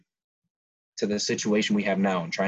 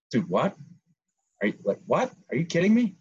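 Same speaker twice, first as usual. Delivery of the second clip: incredulous; 7 semitones higher than usual. Retold or imitated. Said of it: "And it's like".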